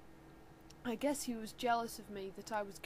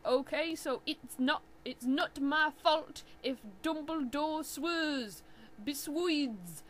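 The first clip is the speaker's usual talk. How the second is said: whiny defensive author; 6 semitones higher, 11 dB louder than usual. Acted. Author ranting at flamers.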